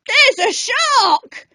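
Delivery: high, urgent cry of alarm. A narrator's reading. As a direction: call out a warning about the shark. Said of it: "somebody cried".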